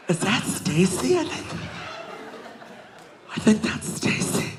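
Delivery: breathy half-whispered disbelief; hushed excited. Retold or imitated. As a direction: gasp it under your breath as you spot her. Drunk girl spots friend.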